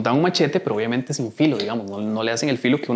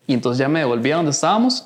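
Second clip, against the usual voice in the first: usual speaking voice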